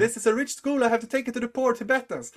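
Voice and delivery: high-pitched, insistent acted plea; impassioned argument. Acted pleading argument with monk.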